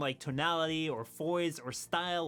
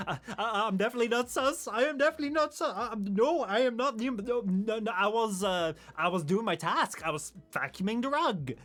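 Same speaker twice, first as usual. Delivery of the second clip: higher, louder, stammering mock protest; mock defensive player; 5 semitones higher, 4 dB louder than usual. Accused Among Us player to the chat, mock defensive. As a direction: protest your innocence in a hammed-up fluster. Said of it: "everyone else in the Among Us chat had to be like".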